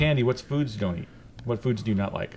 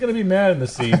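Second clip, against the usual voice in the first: ordinary talk, nothing marked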